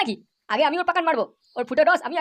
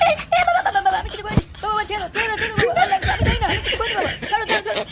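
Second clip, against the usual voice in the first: higher pitched hammed-up begging, half laughing; mock pleading; 4 semitones higher than usual. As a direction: plead with dad to stop hitting. Son beaten by father, begging. (বাবার মার খেয়ে হাসিমাখা নাটুকে অনুনয়)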